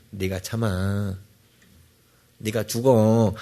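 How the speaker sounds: low, slow and soft, God quietly urging patience; gentle God voice. Pretend. Preacher voicing God calmly telling a fighting spouse to endure. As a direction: hush it down like a gentle inner nudge. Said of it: "하고 하나님 말씀하세요".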